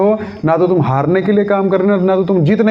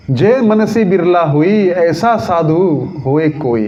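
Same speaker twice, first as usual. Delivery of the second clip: verse recitation; much slower than usual. Acted. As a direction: recite the couplet with long vowels. Kabir का दोहा सुनाते हैं. (दोहा पाठ, धीमे खींचकर)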